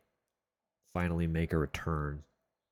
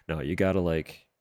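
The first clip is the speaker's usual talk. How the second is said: ordinary delivery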